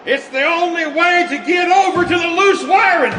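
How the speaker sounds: excited shout. Acted. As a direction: holler it up over the noise. Shouting from the bomb bay.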